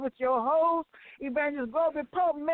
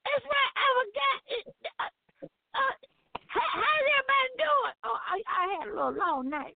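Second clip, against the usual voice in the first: put-on sleepy old lady voice; 6 semitones higher than usual. [name] answers in a put-on voice.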